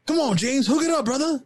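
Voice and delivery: high, hammed-up dub imitation; mock dubbed voice. Gamer mocking a badly dubbed character in a high voice. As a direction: squeak it out like a bad game dub.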